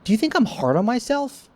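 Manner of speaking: high-pitched self-mocking question. Shown as a demonstration. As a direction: toss it up high like teasing yourself.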